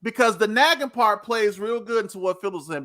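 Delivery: usual speaking voice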